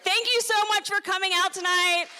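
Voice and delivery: bright club emcee outro; emcee announcement. Real, not acted. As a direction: hype up the crowd for the next act. Host announcing next act.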